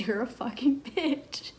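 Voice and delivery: mildly fierce, half-performed swear; retold insult again. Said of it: "the devil came out of me and I said".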